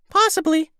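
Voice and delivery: very high, squeaky little creature voice; squeaky helium voice. Acted. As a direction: talk like you sucked a helium balloon. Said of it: "in his squeaky helium balloon voice".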